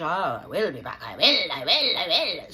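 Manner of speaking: loud repeated sing-song; mimicking the support worker's hollow reassurance; mock promise.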